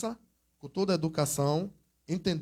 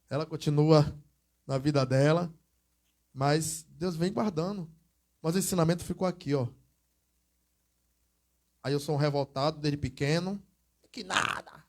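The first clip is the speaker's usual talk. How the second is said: ordinary talk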